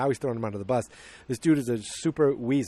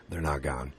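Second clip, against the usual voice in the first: much lower, softer aside; knowing aside; 10 semitones lower, 7 dB quieter than usual. Real, not acted. Narrator correcting the villagers' lie, low and knowing. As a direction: drop low and quiet to contradict the cover story.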